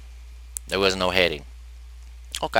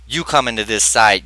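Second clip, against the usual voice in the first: normal talking voice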